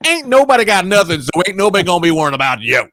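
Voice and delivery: loud, high imitated scolding; hammed-up dismissive woman's voice; sassy lady boss. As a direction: start high and loud as the lady, then rattle it out. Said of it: "contradicted this guy and was like".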